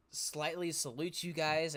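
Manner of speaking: ordinary talk, nothing marked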